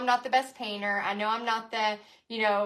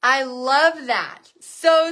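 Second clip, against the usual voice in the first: ordinary delivery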